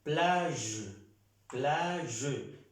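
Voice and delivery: "Plage" ending in a long zh, falling; "plage" as a careful French model with soft G. Shown as a demonstration. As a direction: teach "plage" with the G as zh, no full E. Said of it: "No estoy pronunciando la G con la E".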